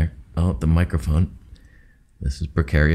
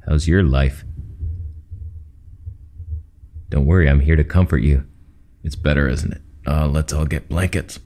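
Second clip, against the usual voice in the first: radio host voice; 6 semitones lower, 4 dB louder than usual. Shown as a demonstration. To viewers under a blanket, demoing a deep, louder radio host voice. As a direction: lower and louder, smooth late-night radio host. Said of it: "I will now demonstrate".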